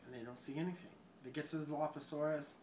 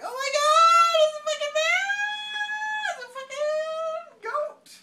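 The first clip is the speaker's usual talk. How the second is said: high, drawn-out hammed-up shriek; retold screaming panic, way up high; mock panicked scream; 13 dB louder, slower than usual. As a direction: squeal each line out long and loud. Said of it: "And they're like".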